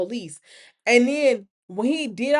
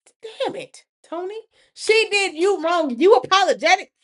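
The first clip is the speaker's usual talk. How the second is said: exasperated yell; 7 semitones higher, 5 dB louder than usual. Retold or imitated. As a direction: scold him in disbelief. Talking to Tony on screen.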